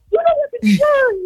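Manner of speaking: high-pitched mock outburst; lightly acted high scolding cry; retold mom shouting. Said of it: "she might shout to that".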